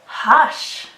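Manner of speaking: showing the sh in hush. Shown as a demonstration. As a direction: hush ending in a long hushed sh. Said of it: "Can you repeat after me".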